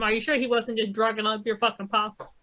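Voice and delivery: ordinary talk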